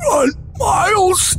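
high, drawn-out strangled cry; strained warning cry. Acted. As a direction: choke out a desperate, high-pitched warning. Dying brother cries out warning.

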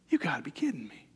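whispered disbelief. Acted. God's incredulous aside.

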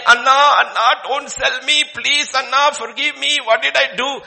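loud, high, desperate begging; pleading boy Joseph. Acted. Young Joseph begging his brothers loudly not to sell him. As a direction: beg your brothers not to sell you.

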